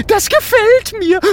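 very high, giggling ghost voice; laughing with delight. A narrator's reading. Gerd delighted by the pig idea, laughing. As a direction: crow it between peals of laughter. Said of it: "lacht Gerd".

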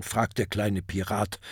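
ordinary delivery

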